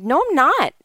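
a retold flash of anger; getting mad. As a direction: snap back in protest. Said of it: "I started to get mad".

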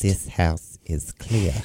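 creepy medium voice. Retold or imitated. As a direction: intone it like a spooky psychic. Quoting Poltergeist medium line.

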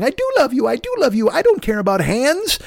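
high-pitched mock girlfriend voice; pleading devoted girl. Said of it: "the girl constantly patting behind him".

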